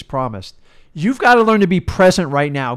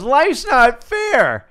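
mocking a complainer, higher and drawn out; whiny complainer; 8 semitones higher, 4 dB louder, much slower than usual. Mimicking people who complain, mock whine. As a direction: parody someone moaning, stretched out slowly. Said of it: "Do you ever have someone goes".

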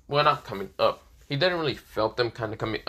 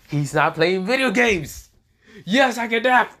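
high, drawn-out mock cheer; excited outburst; 11 semitones higher, 5 dB louder than usual. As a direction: crow it loudly in triumphant glee. Excited exclamation of a retold reaction.

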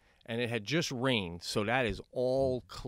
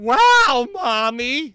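put-on high, loud gushing child's "Wow, Mommy"; mock impressed kid; 10 semitones higher, 14 dB louder, much slower than usual. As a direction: play the awestruck little kid, hammed up.

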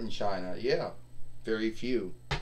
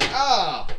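sudden high yelp; 9 semitones higher, 7 dB louder than usual. Pretend. Figure boasting before a match.

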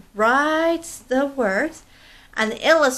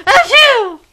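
three sneezes climbing higher and louder; big sneezes; 13 semitones higher, 10 dB louder than usual. Acted. Monkey sneezing at the blue feather, building sneezes. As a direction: sneeze hard, each one bigger. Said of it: "began to sneeze".

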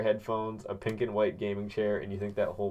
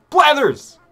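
sudden very high, loud shout; scare shout; 20 dB louder than usual. Acted. Calling out to scare away the game owl, a sudden shriek. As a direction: yell his name to scare him off. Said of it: "I had to scare him away".

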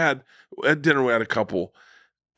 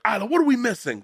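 same line pitched way up and eager; buzzed excitement; 12 semitones higher, faster than usual. Retold or imitated. Father calling back to his daughter in the rain, pitched up and eager. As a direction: call out like the fun's just starting. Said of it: "I don't know if I would have been like".

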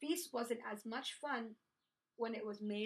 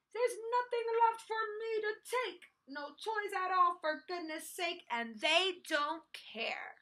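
high, louder complaint, slowing at the end; upset outburst; 7 semitones higher, 4 dB louder than usual. A narrator's reading. Minosaur left with no one, upset. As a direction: whine it out, aggrieved.

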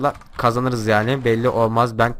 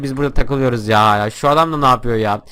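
normal talking voice

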